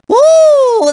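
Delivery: high, loud party whoop; excited whoop. Acted. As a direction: whoop with cartoon glee. Whooping at the dance track.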